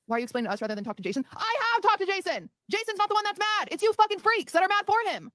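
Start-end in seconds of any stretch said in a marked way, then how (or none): 1.3-5.3 high, loud frustrated yelling; angry yelling. Real, not acted. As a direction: blow up at the chat.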